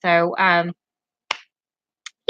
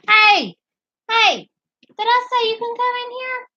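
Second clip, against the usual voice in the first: scolding the dog; 13 semitones higher than usual. Real, not acted. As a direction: catch them in the act and call out. Scolding dogs entering the bedroom.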